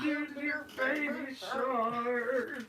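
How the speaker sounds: slow, far [name] style sung scat; [name] impression. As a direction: croon it slow like [name]. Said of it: "my impression of [name]".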